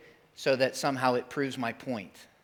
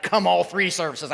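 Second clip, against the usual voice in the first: ordinary delivery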